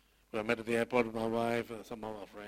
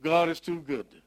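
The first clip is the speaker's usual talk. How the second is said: louder, higher pulpit proclamation; preaching at full force; 4 semitones higher, 7 dB louder than usual. Acted. Pastor exhorts the congregation from his grief, fervent preaching. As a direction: preach it out with conviction.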